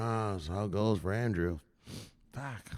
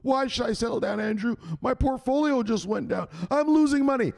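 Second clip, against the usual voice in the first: lightly voiced whiny crypto holder, higher; whiny viewer; 12 semitones higher than usual. A hint of a viewer whining to Andrew about losses. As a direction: complain like a panicking investor. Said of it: "He's gonna tell you to settle down".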